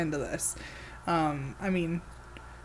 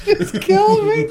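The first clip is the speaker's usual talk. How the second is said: high, loud helpless laughter; laughing; 11 semitones higher, 5 dB louder, much slower than usual. Real, not acted. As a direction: crack up while saying it. Cracking up at impression.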